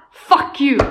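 loud mock "Fuck you!"; a hammed-up loud curse; angry all caps. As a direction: throw it out like a text in capitals. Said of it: "Med stora bokstäver".

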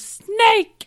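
high, very loud mock alarm; imitated yell. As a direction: shriek it out, imitating a startled yell. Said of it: "So he yells".

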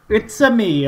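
put-on Italian accent, high and loud; playful Mario impression. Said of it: "I just didn't tell you an accent".

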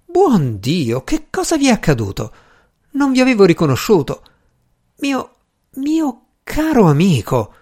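high-pitched, astonished concern for a friend; aghast, rushed at first, then slowing; shocked exclamation. A narrator's reading. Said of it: "esclamò".

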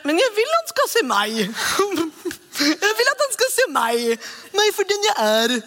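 high, pleading voice, wanting to be seen; pleading believer. Retold or imitated. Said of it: "Og så er det en som er".

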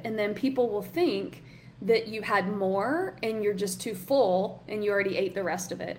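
ordinary talk

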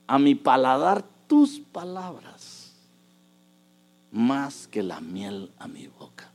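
slow reading that drops to a whisper; reading Scripture aloud, slow and hushed; psalm reading. Acted. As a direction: let the verse fade into a whisper.

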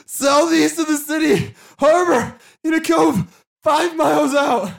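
very high, loud strained cries; wailing prisoner giving it up; agonized confession. Acted. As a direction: force out each phrase through pain. Stabbed prisoner confesses in agony.

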